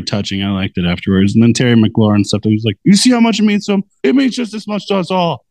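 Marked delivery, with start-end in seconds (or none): 2.8-5.4 high, hurried imitation of a pep talk; mock rousing speech. Host retelling the receiver's locker-room speech, mock rousing. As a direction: imitate a fired-up player rallying teammates.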